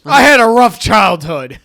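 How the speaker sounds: loud mock-defensive cry; mock outburst. Co-host playfully defending himself after teasing, loud mock outburst. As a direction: blurt it out loudly.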